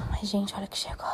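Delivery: soft secretive whisper. Real, not acted. Telling viewers what arrived, in a whisper.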